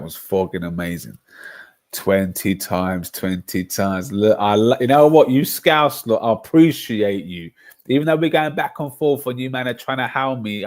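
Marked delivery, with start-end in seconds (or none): 4.1-10.6 raised-voice, emphatic appreciation; loud outburst. Real, not acted. Thanking the hounding Scousers, loud and emphatic. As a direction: burst out louder at the listeners.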